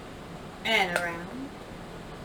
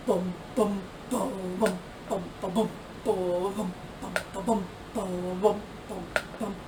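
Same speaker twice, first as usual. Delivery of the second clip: low tuba bum-bums; 4 semitones lower, 7 dB quieter than usual. A narrator's reading. Tuba sounds voiced.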